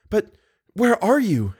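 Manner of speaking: high-pitched anxious captive woman; Christine's questioning voice. A narrator's reading. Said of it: "asked Christine".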